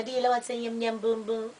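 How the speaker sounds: softly imitating a Chinese accent; caricatured Chinese-girl accent; mock Chinese accent. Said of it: "I turn into the little Chinese girl".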